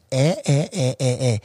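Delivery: every vowel an "eh" in Eagle Rock accent. Shown as a demonstration. Host showing guest how Eagle Rock sounds, Eagle Rock accent. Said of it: "That's the Eagle Rock accent".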